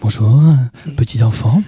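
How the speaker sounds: low, hollow voice from beyond the grave; creepy ghostly low voice; very old voice. Pretend. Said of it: "avec cette voix d'outre-tombe".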